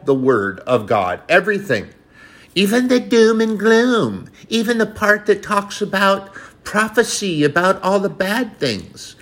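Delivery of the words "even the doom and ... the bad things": preaching. Real, not acted. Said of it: "preaching at full force".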